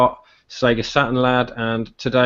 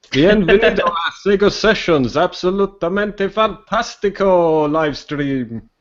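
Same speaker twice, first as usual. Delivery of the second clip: high, hammed-up Spanish show intro; playful, exaggerated Spanish announcer; mock Spanish broadcast; 6 semitones higher, slower than usual. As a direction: draw out the Spanish like a showy live host. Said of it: "do the whole cast in Spanish".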